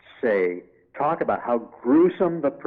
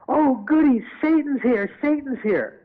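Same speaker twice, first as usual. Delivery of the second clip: giddy excitement; 4 dB louder than usual. Retold or imitated.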